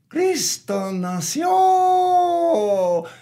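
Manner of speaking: high, drawn-out crowed shout; rooster crow. Acted. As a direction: crow it out like a rooster, last syllable stretched. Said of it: "the rooster crowed".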